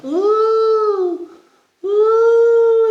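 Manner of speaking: long, loud, high "ooh"; high swinging whoop. Acted. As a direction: whoop like swinging through the air. Possums swinging upside down.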